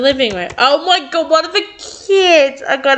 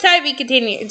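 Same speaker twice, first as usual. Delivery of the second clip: ordinary delivery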